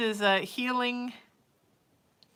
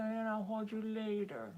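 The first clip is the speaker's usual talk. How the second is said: "And I'll hold you later" voiced, higher, soft cooing; pet talk; 3 semitones higher, 5 dB quieter than usual. Real, not acted. Promising her cat cuddles later, voiced cooing "I'll hold you later".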